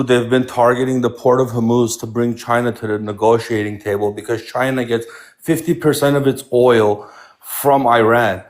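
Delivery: ordinary talk, nothing marked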